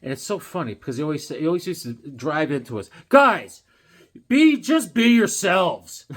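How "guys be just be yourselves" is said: loud, high coach holler, stretched out; mock coach yell. Football coach driving it into the team, mock yell.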